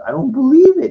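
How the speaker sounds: high, loud startled Luke; Luke in disbelief. Retold or imitated. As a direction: gasp it out, amazed. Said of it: "And he goes".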